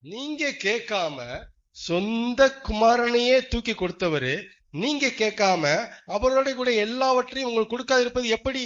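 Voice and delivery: fervent preaching. Acted. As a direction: build it up like a sermon peak. Preaching climax. (உணர்ச்சியுடன் மெதுவான பிரசங்கம்)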